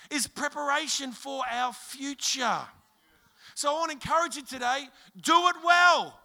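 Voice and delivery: lifted, urgent sermon voice with held-back pauses; rousing preaching. Acted. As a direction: drive the encouragement home to the church. Preaches to the congregation.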